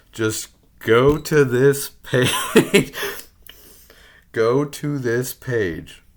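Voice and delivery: exasperated slow shout at Congress; pleading shout. Real, not acted. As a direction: beg them loudly and slowly to just look.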